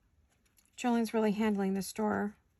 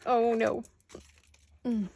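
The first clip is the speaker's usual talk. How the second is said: hiccups; 3 semitones higher, 3 dB louder, slower than usual. Real, not acted. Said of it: "I have the hiccups".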